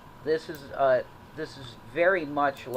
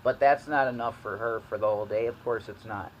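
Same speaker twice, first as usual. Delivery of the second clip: normal talking voice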